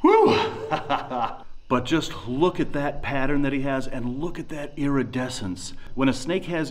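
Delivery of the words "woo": excited whoop and laugh. Real, not acted. Joy at the find.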